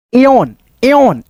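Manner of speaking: slow, stretched एयोन, said ay-yon; drawn-out pronunciation. Shown as a demonstration. Teacher modelling एयोन slowly for the class. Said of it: "एयोन ऐसे करके एयोन".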